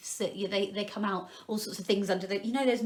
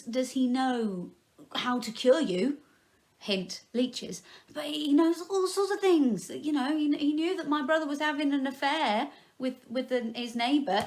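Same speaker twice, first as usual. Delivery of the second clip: usual speaking voice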